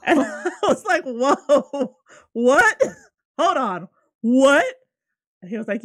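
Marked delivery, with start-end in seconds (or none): 0.1-4.7 laughing shocked disbelief. Retold or imitated. Shocked retelling of seeing entity.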